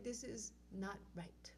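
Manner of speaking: slow, hushed whisper; whispered aside. Retold or imitated. Telling herself the festival is wrong, whispered. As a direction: breathe it as a private aside.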